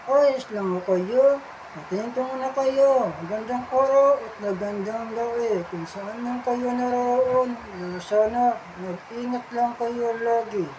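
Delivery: cheerful puppet voice. Acted. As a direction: play a bubbly hand puppet saying hi.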